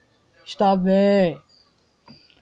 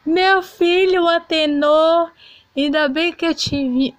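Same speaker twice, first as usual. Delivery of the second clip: mother calling son; 8 semitones higher, 3 dB louder than usual. Acted. Griselda calls out to her son Atenor at college. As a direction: sing out to the boy across the campus.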